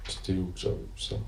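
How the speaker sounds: barely voiced aside to self; muttered aside. Real, not acted. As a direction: mutter it under your breath while searching the page. Cherche un passage dans le livre. (marmonné pour soi-même)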